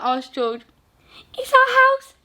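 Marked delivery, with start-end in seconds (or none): 1.4-2.2 high, squeaky little mouse; a small mouse telling the giant her trouble, high and squeaky. Acted. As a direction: speak as a tiny worried mouse. Mouse answers George.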